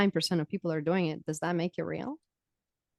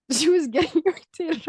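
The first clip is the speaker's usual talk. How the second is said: laughing through the words, higher; 11 semitones higher, 5 dB louder than usual. Real, not acted. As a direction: giggle as you tell it. Laughs while retelling.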